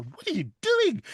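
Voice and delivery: high, exasperated parental what are you doing; parents' exasperated what. Retold or imitated. Parents catching a kid doing Irish accent, mock exasperated. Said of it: "my parents would just go".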